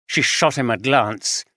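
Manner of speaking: usual speaking voice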